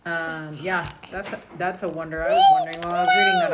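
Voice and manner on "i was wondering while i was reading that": a fast, high burst of excitement; excited. Real, not acted. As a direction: blurt it out all at once.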